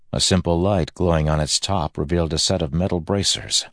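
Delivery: ordinary delivery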